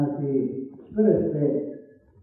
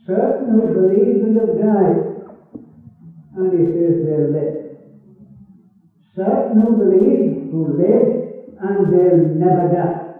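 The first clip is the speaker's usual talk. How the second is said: emphatic preaching; 3 semitones higher, 5 dB louder than usual. Acted. Preacher emphasises two groups.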